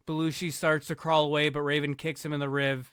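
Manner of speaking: normal talking voice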